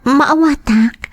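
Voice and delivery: breathy whispered reply, barely voiced. Mistress whispers to Meliton. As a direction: whisper it close, as a secret. Lover answers softly.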